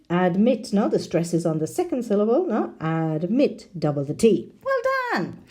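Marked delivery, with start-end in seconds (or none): 4.6-5.4 high praise. Real, not acted. Teacher cheering students for a right answer, pitched up high.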